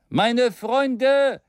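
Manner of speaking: lightly voiced orator; rousing address. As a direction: call the room to attention.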